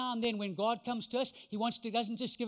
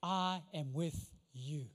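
lower, softer, very slow promise; reassuring God; 7 semitones lower, 5 dB quieter, much slower than usual. Retold or imitated. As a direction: promise it gently and slowly. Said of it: "You know what he says?".

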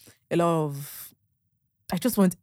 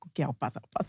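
soft, lower, resigned okay; meek giving in; 5 semitones lower, 9 dB quieter, faster than usual. Retold or imitated. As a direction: give in to the husband quietly. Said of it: "I'm like".

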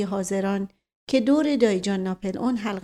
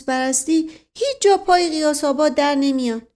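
higher, louder comic servant voice; high-pitched, emphatic protest; indignant interruption; 5 semitones higher, 5 dB louder than usual. A narrator's reading. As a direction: jump in loudly to defend your village. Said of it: "به میان حرف دوید".

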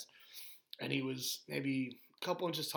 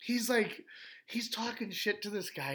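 ordinary delivery

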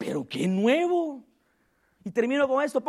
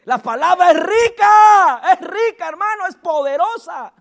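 fervent exclamation; 4 semitones higher, 6 dB louder than usual. Real, not acted. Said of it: "fervent exclamation".

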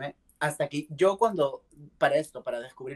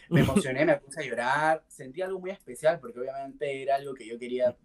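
ordinary talk

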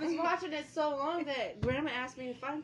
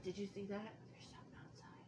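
hushed, unvoiced-sounding whisper; Ryan; 15 dB quieter than usual. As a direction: whisper it as if something is lurking. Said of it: "Ryan will be like".

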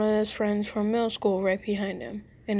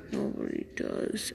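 hushed, barely voiced and softer, heavy with regret; weighed down with regret; 8 dB quieter than usual. A narrator's reading. The bully admits to Ben that nobody deserves it, hushed with regret. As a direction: let it sink under the regret, almost no voice. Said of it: "his words weighed down with regret".

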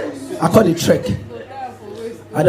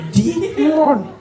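high demon voice; 7 semitones higher than usual. Acted. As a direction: tease him slowly in a thin high demon voice. Demon voice in acted dialogue.

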